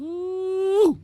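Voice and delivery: excited ooh. Real, not acted. Hyped reaction to guest's name.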